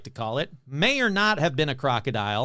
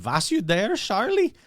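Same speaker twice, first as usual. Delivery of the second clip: mock German accent, Charlie swooping way up; playful fake German accent with a rising Charlie; 5 semitones higher than usual. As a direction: toss off the radio line in a jokey German accent. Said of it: "some guy with a fake German accent said".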